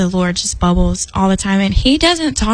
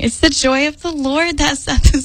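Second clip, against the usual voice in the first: higher, with laughter still in it; giggly; 5 semitones higher than usual. Real, not acted. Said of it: "I'm just giggly tonight".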